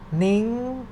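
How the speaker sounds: "Ning" high and climbing. Shown as a demonstration. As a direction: stretch "Ning" upward. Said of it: "represent the high sound".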